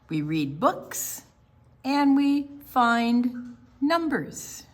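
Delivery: slow, clear picture-book reading; reading to children. Acted. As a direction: read it out to a room of kids. Reads picture book aloud.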